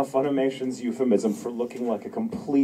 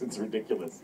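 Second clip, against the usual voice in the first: ordinary talk, nothing marked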